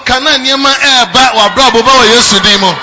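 loud, climbing preacher's proclamation; full-force preaching. Real, not acted. Preacher blessing the congregation, full-force preaching. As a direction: build the blessing to a shout.

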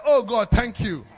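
put-on recalled boyhood relief, loud and slow; loud relieved thanks. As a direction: belt out the relief. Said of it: "And I said".

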